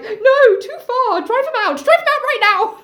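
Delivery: calling out. Acted. Oedipus calling on the chorus to act, high outraged call. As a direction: call frantically for help in driving him out. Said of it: "Oedipus calls out to the chorus".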